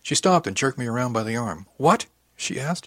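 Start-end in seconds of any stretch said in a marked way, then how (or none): 1.8-2.9 rising, startled query; taken aback, voice jumping up; shocked question. A narrator's reading. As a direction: react in sudden disbelief. Shocked at the academy news.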